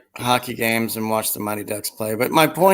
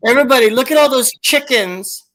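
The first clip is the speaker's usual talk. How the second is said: high, loud exclamation to everybody; sing-song high marvelling call; excited clip imitation; 11 semitones higher, 4 dB louder than usual. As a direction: mimic the viral clip, pitched way up. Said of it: "but she's like".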